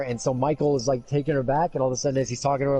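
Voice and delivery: normal talking voice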